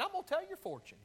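high, fast mock fortune teller line; "gonna" lifted high like a carnival seer. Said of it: "I'm gonna be a fortune teller".

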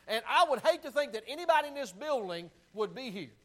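loud, high fervent warning; preaching at full force. Real, not acted. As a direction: preach it out hard to the crowd.

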